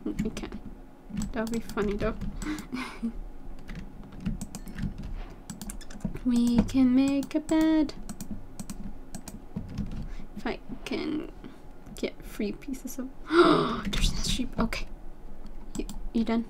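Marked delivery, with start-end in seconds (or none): 2.2-5.2 quiet airy laugh under the breath; a hushed little chuckle; muffled giggle; soft breathy giggle. Real, not acted. Quiet laugh at own joke. 10.4-13.1 unvoiced muttered whisper to herself; hushed private whisper. Real, not acted. Whispering while crafting. 13.1-13.9 quick delighted gasp; sharp little gasp. Real, not acted. Spots a sheep.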